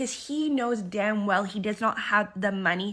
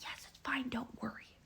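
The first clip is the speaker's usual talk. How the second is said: whispered, much softer, hushing a friend; hushed reassurance; 3 semitones higher, 11 dB quieter than usual. Retold or imitated. Emmett quietly reassuring Jackie about the prices, whispered. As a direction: quietly wave off worry at the table. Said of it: "He's like".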